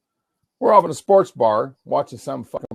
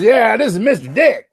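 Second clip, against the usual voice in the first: high, loud, gleeful introduction; relishing the name; 9 semitones higher, 6 dB louder than usual. Retold or imitated. Friend introduces him at the barbecue, loud and delighted. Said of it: "He loves saying my name".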